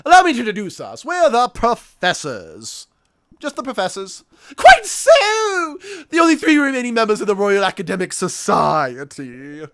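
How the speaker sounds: put-on high, pompous old scholar; posh professor voice. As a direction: play an eccentric English academic.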